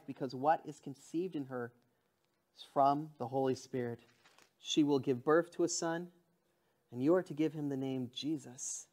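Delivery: measured Bible reading; scripture reading. Acted. Pastor reading the angel's words to Joseph aloud.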